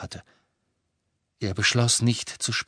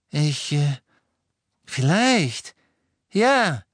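hesitant Draco; 6 semitones higher, 5 dB louder, much slower than usual. A narrator's reading.

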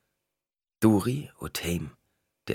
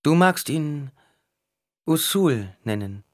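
much higher, louder woman's voice; Duri's voice; 9 semitones higher, 4 dB louder, slower than usual. A narrator's reading. Duri tells Bias he has a new master, higher voice. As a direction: tell the dwarf gravely.

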